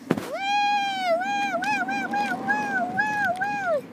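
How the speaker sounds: high squealing woo; high excited squeals; delighted doll. Pretend. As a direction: squeal with joy at the pool.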